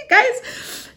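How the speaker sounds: high, breathy little laugh; a soft embarrassed giggle. Real, not acted. Laughing at own crying story.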